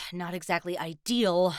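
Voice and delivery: normal talking voice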